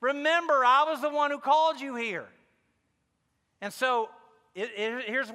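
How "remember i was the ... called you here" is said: raised, higher voice of God calling him back; God stopping him short, a higher and louder reminder; voicing God. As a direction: remind him who called him, firmly. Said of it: "God's like".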